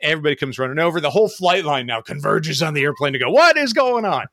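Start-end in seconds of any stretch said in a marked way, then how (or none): 3.3-4.2 high, loud alarmed cry of what is going on; alarmed shout. Retold or imitated. Retelling the flight line crowding the jet, alarmed and high. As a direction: call it out like a startled crew. Crew rushing to the wing.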